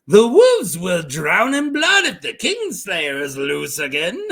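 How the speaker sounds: a lightly put-on accent, slowed down; Greenbeard accent try. Shown as a demonstration. Second take of Greenbeard's warning, lightly accented. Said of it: "So I'll go with that".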